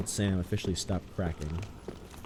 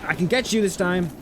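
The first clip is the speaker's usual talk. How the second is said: squeaky voice; 10 semitones higher, 6 dB louder than usual. Pretend. Cracked-voice bit continues.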